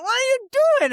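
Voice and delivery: very high, loud shriek of protest; outraged yell. Retold or imitated. Kid yelling at dad after being doused, outraged shriek. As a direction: imitate a drenched kid yelling in disbelief.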